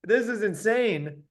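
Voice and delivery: high, loud, incredulous outburst; stunned excitement. Retold or imitated. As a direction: sound like you can't believe your luck. Stunned at Billy buying jackets.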